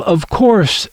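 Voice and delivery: usual speaking voice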